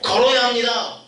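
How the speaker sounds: imitating a preacher's slow declaration; preacher proclaiming. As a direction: proclaim it slowly from the pulpit. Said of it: "믿음의 선포를".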